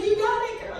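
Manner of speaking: put-on high-pitched sassy "you got it, girl"; sassy affirmation. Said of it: "I was like".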